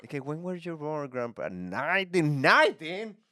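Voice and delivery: high, incredulous teasing; mocking grandchild. Kid teasing Grandpa about his age, mocking. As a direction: jeer at an old relative, stretching the year.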